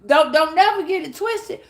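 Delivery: normal talking voice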